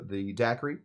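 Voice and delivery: ordinary talk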